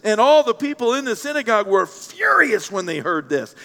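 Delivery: animated reading, leaning hard on furious; emphatic reading. A narrator's reading. Preacher rereads the crowd's turn, emphatic. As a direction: punch out the verse with rising indignation. Verse reread after the shocked reaction.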